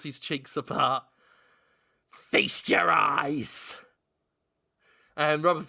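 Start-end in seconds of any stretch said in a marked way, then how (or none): 2.1-3.8 slow drawn-out booming invitation; grand proclamation. Acted. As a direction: stretch it out theatrically.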